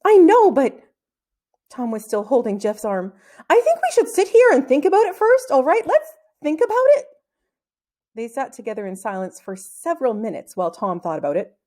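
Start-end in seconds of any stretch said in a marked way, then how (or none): 0.0-7.1 very high, louder scared plea; frightened pleading. Acted. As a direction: desperately stall for time. Terrified boy pleading to wait.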